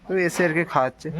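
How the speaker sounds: talking with paan in mouth; chewing paan. Real, not acted. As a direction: mouth full of paan while speaking. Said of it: "গালে পান নিয়ে বসলাম".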